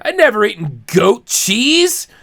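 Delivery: ordinary talk, nothing marked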